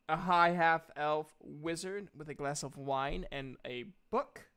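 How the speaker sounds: normal talking voice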